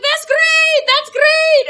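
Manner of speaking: very high, bright gushing repeat; gushing nurse. Retold or imitated. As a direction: squeal it like an overjoyed nurse. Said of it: "She's like".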